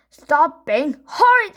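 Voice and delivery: high, loud telling-off; scolding. A narrator's reading. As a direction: snap at the child. Parent scolding.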